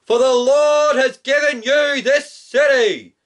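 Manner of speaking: high, ringing battle cry; Joshua's shout. Acted. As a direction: shout it out like a commander rallying troops. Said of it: "Joshua shouted out".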